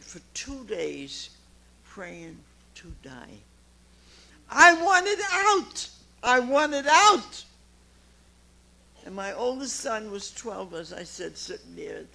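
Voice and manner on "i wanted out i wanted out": high, loud and slow wail; desperate cry. Retold or imitated. Alone in the basement praying to die, crying out loud. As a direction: cry out in despair.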